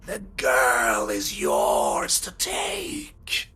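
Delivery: slow, drawn-out eerie creature voice, whispery in places; sinister mind-invading villain. Acted. Villain taunting the players inside their minds, slow sinister voice. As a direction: stretch the words out like a haunting presence.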